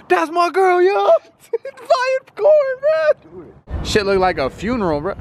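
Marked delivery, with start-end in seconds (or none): 0.1-3.4 high cheering yell; mock yelling fan. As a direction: cheer her on like a hype man. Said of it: "What if I start yelling".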